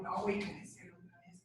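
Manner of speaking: soft confiding whisper. Shown as a demonstration. Walking close beside a friend, whispering a secret.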